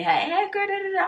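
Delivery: put-on light, high girly hello; high-pitched greeting. Friend greets her as she walks in, high-pitched.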